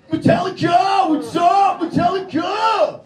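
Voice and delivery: metal fan roar. Retold or imitated. A fan yelling the band name at a stranger, screamed high and loud. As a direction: roar it like a crazed metal fanatic at a concert. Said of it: "No, they're like".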